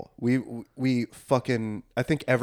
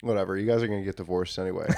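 fast, soft, dismissive mutter; 4 semitones lower, 7 dB quieter, faster than usual. Retold or imitated. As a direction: toss it off, not caring. Dismissive thought at boring weddings.

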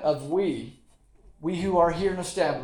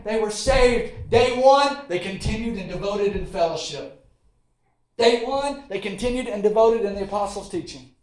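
preaching at full force; 2 semitones higher, 5 dB louder than usual. Acted. Preacher rouses the church about the early believers, full force. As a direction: preach it, hammering each Day one. Preaching climax on day one.

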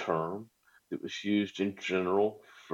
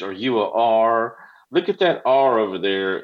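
playground taunt; 3 semitones higher, 8 dB louder than usual. Retold or imitated. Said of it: "used in the playground by kids to tease".

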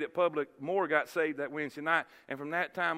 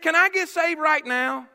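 high, direct question; matter-of-fact request; 8 semitones higher, 7 dB louder than usual. Retold or imitated. Young man interrupting the preacher, matter-of-fact request. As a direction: ask it straight out, just like that. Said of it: "It was just that matter of fact".

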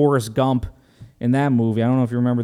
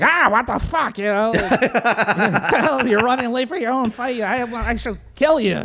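high, hammed-up gruff trainer, laughing; mock Mickey scolding; 10 semitones higher than usual. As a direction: scold the late fighter like a grumpy old coach. Mickey scolding Rocky retold.

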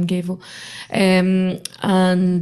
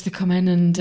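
ordinary delivery

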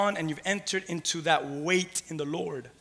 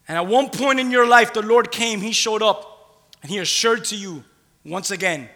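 preaching at full force; 5 semitones higher, 7 dB louder than usual. Acted. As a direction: lift it up and declare it.

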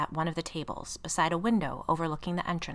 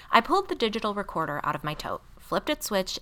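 ordinary delivery, nothing marked

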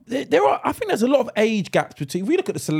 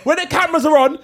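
ordinary talk, nothing marked

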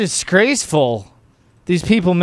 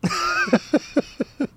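very high, quieter cackle; helpless laugh; 7 dB quieter than usual. Real, not acted. Host cracking up at the clip, helpless laugh.

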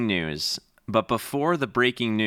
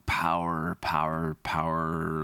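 low, soft repetition while puzzling it over; quietly turning the word over; musing mutter; 5 semitones lower, 5 dB quieter, slower than usual. Acted. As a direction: mull the word over under your breath. Puzzled interviewee mulls over the word.

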